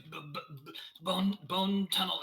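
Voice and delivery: high, stuttering with fear; frightened stammer. Acted. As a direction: stammer it out, terrified. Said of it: "They're very frightened".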